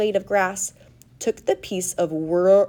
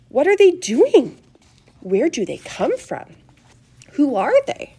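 astonished questions called out; animated, wondering onlooker; excited shouting; 6 semitones higher, 6 dB louder than usual. A narrator's reading. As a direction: fire out amazed questions. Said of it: "everybody started shouting at once".

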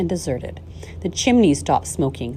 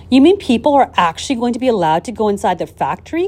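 loud, high burst of amazement; astonished cry; 6 semitones higher, 6 dB louder than usual. A narrator's reading. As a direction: cry out as if you can't believe it. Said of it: "cried Grandpa Joe".

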